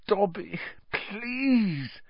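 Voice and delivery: high, soft begging; desperate pleading. A narrator's reading. Harry begging the elf, desperate pleading.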